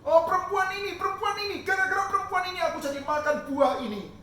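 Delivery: high, loud defensive blaming; blaming whine. Acted. Adam blaming the woman before God, high loud whine. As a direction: point the finger, pleading.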